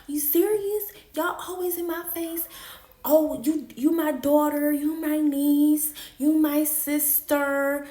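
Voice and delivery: high, loud furious cussing out of his family; furious retold rant. To his family after catching him with a girl, furious high yelling. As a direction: scream it at the whole family, hurt and raging. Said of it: "cussing everybody out".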